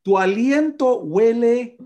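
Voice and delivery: loud, high, slow complaint; disgusted outcry. Retold or imitated. Husband imitates wife reacting to morning breath, loud disgust. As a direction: stretch each word out high and loud.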